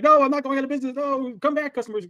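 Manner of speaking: high, loud whiny plea to customers; mock desperate pleading. S0 mocking a shopkeeper begging on the street, high and loud. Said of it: "not on the street like".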